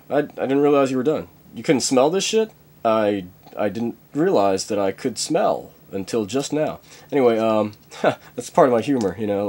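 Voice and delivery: ordinary delivery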